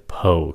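"poke" falling in pitch; dropping tone. Shown as a demonstration. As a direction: let the voice drop on "poke". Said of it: "xuống giọng".

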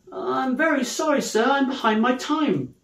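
high, meek apology; apologetic clerk. Acted. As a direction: plead humbly with a stern boss. Late clerk apologises to boss.

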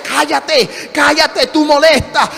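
annoyed onlookers, lightly acted; scolding crowd. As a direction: shut him up, high and insistent. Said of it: "Y la gente le decía".

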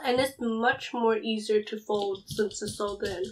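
speaking with food in mouth; eating pizza mid-sentence; chewing while talking. Real, not acted. Seen 0: folded slice held at mouth, jaw moving between bites.

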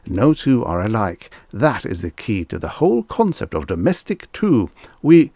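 high-swooping, insistent explanation; fussy salesman. Acted. Salesman explaining brain wavelengths to impatient customer, fussily insistent. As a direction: explain with big pitch swings, eager to placate.

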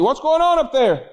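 mock holler up to the kids; hollering upstairs. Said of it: "he hollers down the stairs".

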